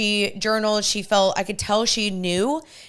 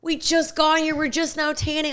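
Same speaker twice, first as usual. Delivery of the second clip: higher-pitched, whiny retold complaint; reenacted protest, pitched up; pleading protest; 7 semitones higher than usual. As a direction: plead to stay a bit longer. Retelling her protest to friends.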